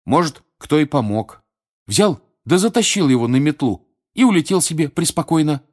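высокий, громкий голос увлечённого мальчишки; выпалить догадку с азартом; оживлённый Рон. Acted. (оживлённо, громко, повыше)

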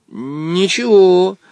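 slow, steady show of courage; a boy bracing himself; brave reply. Acted. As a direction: say it bravely, drawn out. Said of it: "мужественно ответил Женя".

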